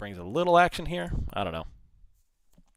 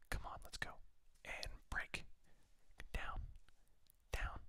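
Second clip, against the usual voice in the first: whispered urging; will the chart down under your breath; tense whisper; 20 dB quieter than usual. Real, not acted.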